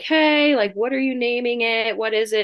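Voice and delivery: a hint of a cheerful hospital worker; curious nurse. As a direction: ask brightly like a friendly nurse. Hospital staff voiced asking birthmother.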